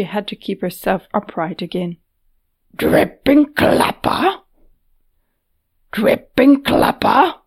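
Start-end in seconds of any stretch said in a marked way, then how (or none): 2.8-4.4 slow, louder gruff exclamation; a gruff brute blurting in shock. Acted. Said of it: "Patat's gruff voice exclaimed". 5.9-7.4 slow, louder gruff exclamation. Acted. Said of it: "Patat's gruff voice exclaimed".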